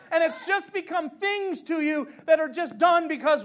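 impassioned sermon building in volume; full-force preaching. Real, not acted. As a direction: preach it out to the whole room, pitched high.

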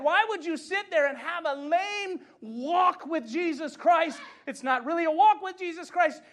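impassioned sermon building in volume; full-force preaching. Real, not acted. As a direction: preach it out to the whole room, pitched high.

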